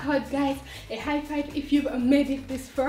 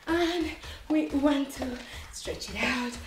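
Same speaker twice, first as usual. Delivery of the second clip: soft, gentle stretching cues; calm cool-down guide; 2 semitones lower, 7 dB quieter than usual. Acted.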